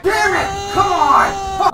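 high, loud outburst; frustrated shout. Real, not acted. Player cursing a lost fight, frustrated shout. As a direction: yell in frustration.

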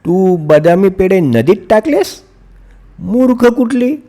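fake-shout at her, half-joking; mother acting angry at daughter; pretend scolding. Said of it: "खोटं खोटं त्या नीलावर ओरडण्याचं नाटक केलं".

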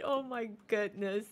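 usual speaking voice

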